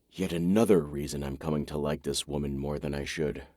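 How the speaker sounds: ordinary delivery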